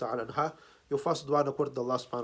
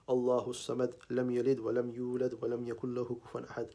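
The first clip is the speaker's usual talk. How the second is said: low-key, even-toned Arabic recitation; Quran recitation; 3 semitones lower, 3 dB quieter, much faster than usual. Real, not acted. Preacher leads listeners in Al-Fatiha for the departed, reciting evenly. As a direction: recite the verses evenly, in a measured near-monotone.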